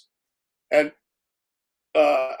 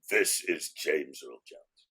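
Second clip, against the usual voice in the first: very low, slow, soft rumble; hushed bass phone greeting; James Earl Jones voice; 11 semitones lower, 10 dB quieter, much slower than usual. Retold or imitated. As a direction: sink deep and slow into his bass.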